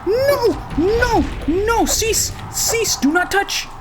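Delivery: high, loud repeated cries of no; frantic shouting. Real, not acted. Player shouting at the ghost to stay back, frantic. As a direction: yell it at the ghost in real alarm.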